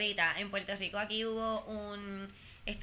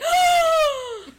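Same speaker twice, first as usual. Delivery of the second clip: louder and higher, building to a gasp; mock gasp of surprise; 14 dB louder than usual. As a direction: play up his shocked sigh. Imitando el suspiro de Omar. (suspiro exagerado de sorpresa)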